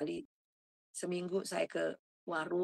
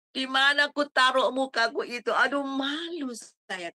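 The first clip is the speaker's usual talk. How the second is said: ashamed lament; 5 semitones higher, 6 dB louder than usual. Retold or imitated. Ashamed cry to God.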